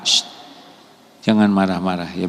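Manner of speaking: usual speaking voice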